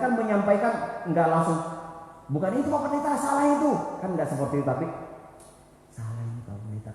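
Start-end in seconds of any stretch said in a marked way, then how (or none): 2.3-3.7 높고 대놓고 지적하는 말투; 목사님께 바로 틀렸다고 외치듯; blunt correction. Shown as a demonstration. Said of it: "Kan agak kecil suaranya". 5.9-6.9 낮고 훨씬 작게 조심스레; 속삭이듯 작은 목소리로 살짝 정정; small quiet voice. Shown as a demonstration. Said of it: "Kan agak kecil suaranya".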